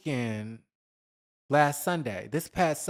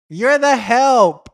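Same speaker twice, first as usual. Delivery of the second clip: loud, slow, higher put-down; punchline put-down; 6 semitones higher, 9 dB louder, slower than usual. Retold or imitated. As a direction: drag it out and land it hard. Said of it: "And it's just like".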